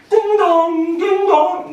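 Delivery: high sung ding dong like a doorbell; doorbell imitation. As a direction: sing the chime of a doorbell.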